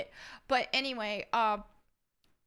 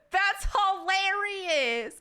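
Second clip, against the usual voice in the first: slow, high and loud through real laughter; cracking up at a fan's question; laughing; 9 semitones higher, 6 dB louder, much slower than usual. Real, not acted. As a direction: burst out laughing as you say it.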